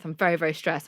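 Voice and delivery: ordinary talk, nothing marked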